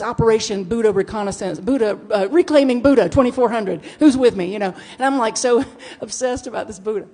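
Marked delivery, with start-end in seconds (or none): 0.0-4.5 louder rallying battle cry; rally the troops for the mission; military rally. Retold or imitated. Mock army gif text, louder rallying battle cry. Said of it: "And it says".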